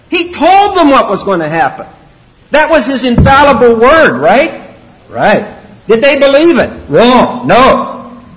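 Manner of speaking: a hammering call-and-answer that rises to a shouted Wrong; preaching at full force. Real, not acted. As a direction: hammer it to the congregation.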